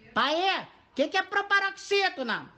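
high, slow mock kid voice; imitated child asking. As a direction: pipe up like a child asking dad. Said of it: "Vai cair no meu colo". (imitação aguda de criança perguntando ao pai)

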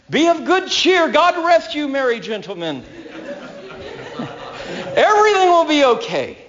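laughing, bright mock Christmas greeting; Jesus as a cheery Christmas caroler. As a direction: say it like a jolly holiday wish, chuckling. Preacher voicing Jesus cheerfully.

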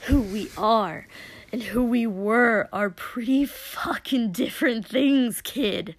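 loud, biting retort; angry, pointed put-down; snapped. Acted. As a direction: snap back harshly, easing off on the last word. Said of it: "Dobby snapped, suddenly sounding lucid".

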